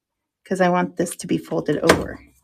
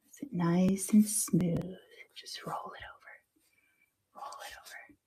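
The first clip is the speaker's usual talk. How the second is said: soft unvoiced whisper while working; 14 dB quieter than usual. Real, not acted. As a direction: breathe the words, careful and focused. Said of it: "I don't know why I'm whispering".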